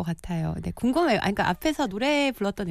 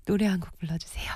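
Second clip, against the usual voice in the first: whispering; 3 dB quieter, faster than usual. Real, not acted. PD asks guest to sing.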